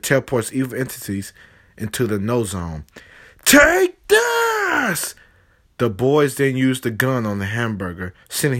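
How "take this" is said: very high, loud yell; battle shout. Acted. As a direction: shout as you fire. Firing the ultimate weapon.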